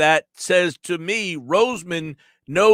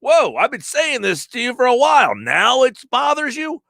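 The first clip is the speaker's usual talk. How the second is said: high, incredulous whoa, pitched way up; mock surprise; 9 semitones higher than usual. To a buddy having a bad day, incredulous and high. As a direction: act stunned your buddy suddenly minds. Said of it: "you're like".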